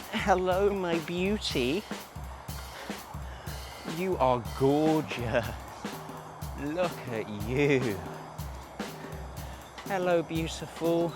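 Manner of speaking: soft, slow, tender cooing; cooing to animals. Real, not acted. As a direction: coo gently at the cows. Cooing softly to cows.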